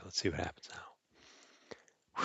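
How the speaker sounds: slow, hushed suspense whisper. Acted. Suspense before time travel.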